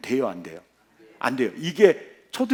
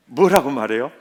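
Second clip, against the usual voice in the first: 뭐라고 말해요 asked again through a laugh, louder; 3 semitones lower, 6 dB louder than usual. Shown as a demonstration. 회중에게 다시 묻는 설교자, 웃으며.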